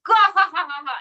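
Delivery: loud cartoon cackle, fading out; hammy puppet laugh. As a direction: ham up a big puppet laugh. Puppet laughs at own joke.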